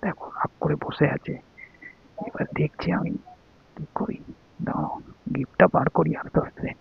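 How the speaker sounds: near-whispered aside, very soft; secretive hush. Real, not acted. Husband sneaking out the gift while telling viewers, hushed. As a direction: keep it under your breath.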